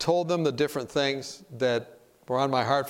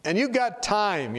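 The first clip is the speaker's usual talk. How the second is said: ordinary talk, nothing marked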